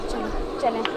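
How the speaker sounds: usual speaking voice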